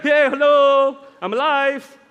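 loud, high playful holler; hammed-up jubilant hello; mock triumphant greeting. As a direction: call out as if showing off to old foes. Imagined risen Jesus showing off.

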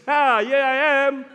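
loud, high gloating announcement; playfully shouted reveal; triumphant taunt. Pretend. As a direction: crow it as a mock gotcha. Imagined risen Jesus surprising Pharisees.

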